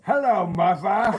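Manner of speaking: slow, high and loud posh British voice; mock British accent. Husband doing British accent on request from wife. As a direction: ham up a British greeting, laughing. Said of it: "you do the British one".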